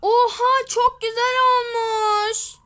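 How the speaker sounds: loud, high cry of delight; delighted shout. Pretend. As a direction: shout with joy at the new haircut. Customer delighted with new hair.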